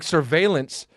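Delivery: normal talking voice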